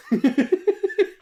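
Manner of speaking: loud, high burst of laughter; high squealing laugh. Real, not acted. Laughing at own [name] joke, high squeal.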